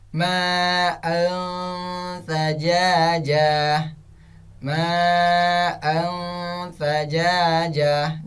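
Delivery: very slow chanted "Ma'an sajajah", veiled n; long vowels and held nasal in "Ma'an sajajah"; ikhfa recitation. Shown as a demonstration. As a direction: hum the hidden n of "Ma'an". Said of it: "contoh yang kedua".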